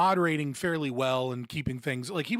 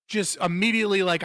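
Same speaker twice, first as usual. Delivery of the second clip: ordinary delivery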